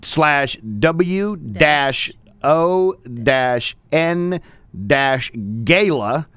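slow, spaced-out spelling with pauses; slow dictation. Real, not acted. Host giving listeners a web link, slow dictation. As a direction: read it out piece by piece for listeners to copy.

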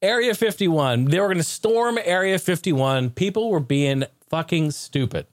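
ordinary talk

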